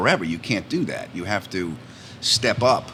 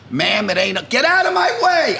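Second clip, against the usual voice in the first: high, loud yell rising to a shriek; mother yelling; 11 semitones higher, 4 dB louder than usual. Retold or imitated. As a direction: push past them shouting. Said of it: "I hear her yelling".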